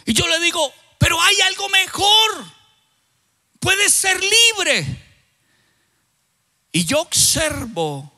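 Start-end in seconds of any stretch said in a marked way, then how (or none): 1.0-4.8 proclaiming. Retold or imitated. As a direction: announce freedom like a preacher. Preacher proclaims freedom to man.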